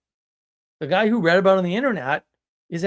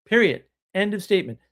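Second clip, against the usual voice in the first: certain declaration; 4 semitones higher than usual. Shown as a demonstration. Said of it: "You're willing to say".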